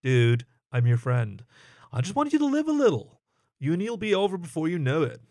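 pushing a friend to live a little; friendly, insistent plea; earnest hyena. Acted.

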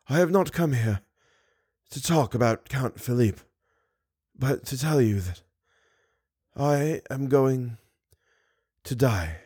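barely holding on to the words; weak and dying. Acted. Dying Eric confesses his end.

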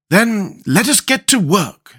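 all business, ready to start; businesslike. A narrator's reading. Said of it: "in a businesslike tone".

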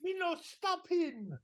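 high, slow, hammed-up Italian accent. Super criminal refusing the police order, hammy Italian accent. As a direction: defy them in a cartoon Italian accent. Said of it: "I mean, the accent is something else".

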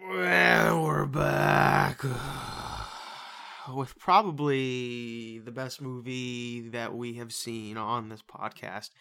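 slow drawn-out return; slow announcer. Pretend. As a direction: ease back in, awed. Returning after watching the movie.

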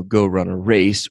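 normal talking voice

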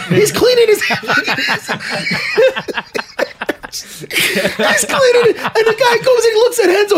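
can barely finish for laughing; laughing hard. Real, not acted. Cracking up at his own story.

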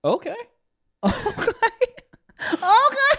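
surprised okay. Real, not acted. Rising repeated okay.

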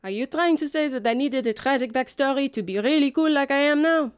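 a touch of scorned-wife indignation; indignant jilted wife. Acted. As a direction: challenge an ex-lover's nerve.

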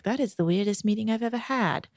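lightly put-on British accent; British boyfriend. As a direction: imitate a British boyfriend, quietly. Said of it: "Tom's British, by the way".

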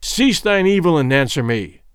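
ordinary delivery, nothing marked

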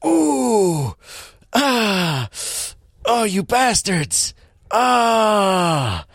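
high, drawn-out hammy wails of pain; mock pained cries. Henchman losing a fingernail to pliers, badly acted pain. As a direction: wail as if merely kicked in the shin. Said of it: "it's like somebody, like, kicked him in the shin".

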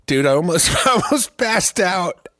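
high, loud, giddy after laughing; real laughing fit aftermath. Real, not acted. Explaining a near faint from laughing, real high giddy voice. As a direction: talk while reeling from a huge laugh. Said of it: "I did some weird laugh thing".

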